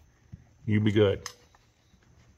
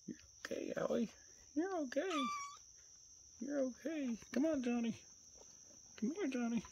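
very soft, high coaxing voice; soothing pet talk; 15 dB quieter than usual. Real, not acted. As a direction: reassure a timid animal in a tiny high voice.

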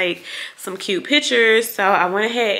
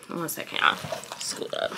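unvoiced hushed aside; whispered aside; 4 semitones lower, 9 dB quieter than usual. Real, not acted. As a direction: whisper it off to the side. Aside off camera.

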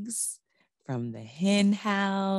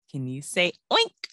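usual speaking voice

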